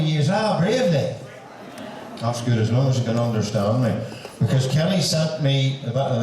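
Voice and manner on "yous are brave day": slow, high-pitched local Ulster greeting; local dialect. Acted. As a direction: say it as Ulster country folk would. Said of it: "as they say in our part of the country".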